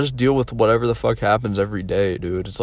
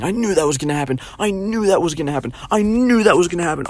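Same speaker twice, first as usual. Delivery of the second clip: mom's nagging refrain; 7 semitones higher than usual. Retold or imitated. Mom after a bike fall, mocking nag building higher. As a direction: repeat it like a nagging parent, building up. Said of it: "kept saying ... over and over".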